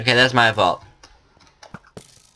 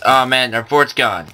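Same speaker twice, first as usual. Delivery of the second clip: ordinary delivery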